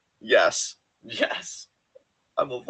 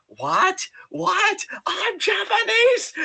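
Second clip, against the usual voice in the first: shrill panicked yelp, climbing high; freaking out; 6 dB louder, much slower than usual. Retold or imitated. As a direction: squeal it out in a panic. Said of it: "And just freaking out".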